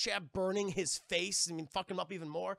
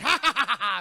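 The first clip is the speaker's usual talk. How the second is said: higher, much louder derisive laughing; mocking crew laughter; 5 semitones higher, 12 dB louder than usual. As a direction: laugh in her face like a rowdy crew. Pirates mock her demand.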